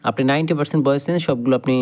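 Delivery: usual speaking voice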